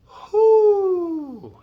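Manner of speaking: high falsetto woo like an owl; high owl hoot. Shown as a demonstration. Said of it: "like a dove or like an owl".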